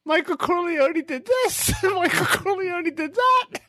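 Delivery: very high squealed delivery; hammed-up squeaky recital between laughs; mock outburst.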